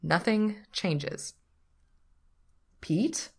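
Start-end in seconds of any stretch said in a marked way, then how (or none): 2.8-3.3 higher, questioning call of a name; repeating himself. A narrator's reading. Patrick checks on Pete, repeating himself. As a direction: try to get his attention once more. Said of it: "in the tone that means he's repeating himself".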